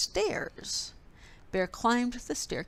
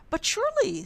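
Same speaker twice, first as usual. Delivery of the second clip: high protest; 13 semitones higher, 5 dB louder, faster than usual. A narrator's reading. Mouse protesting.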